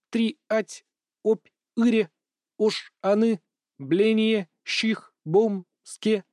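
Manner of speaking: broken robot speech with gaps; choppy mechanical voice. Acted. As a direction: drop syllables like a glitching speaker. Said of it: "говорил с какими-то непонятными паузами". (механический голос с паузами)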